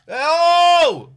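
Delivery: a big loud "Oh!" of disbelief; loud exasperated oh. Real, not acted. Reacting to the shop wanting a booking, a loud exasperated oh. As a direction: throw out a loud groaning oh.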